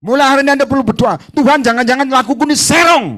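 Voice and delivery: high, loud, fast preaching; fiery preaching. Real, not acted. Preacher warning his congregation at full force, loud. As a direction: drive the warning home.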